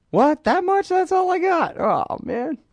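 mock dismay at a shrunken paycheck; shocked at the paycheck. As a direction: play a guy staring at his tiny paycheck, voice jumping up. Acting someone seeing small paycheck.

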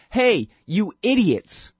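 slow, drawn-out contemptuous "hey"; a prime minister scorning Texan Christians, mock sneering; mock Netanyahu sneer. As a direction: sneer it down at the listener, slowly. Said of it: "he says to you".

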